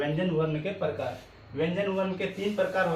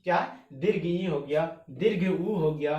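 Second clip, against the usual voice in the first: ordinary delivery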